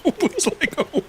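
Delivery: laughing. Retold or imitated. Repeated Dorothy quote.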